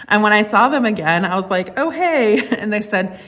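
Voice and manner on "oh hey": awkward greeting. Retold or imitated. Awkward meeting after ignored texts.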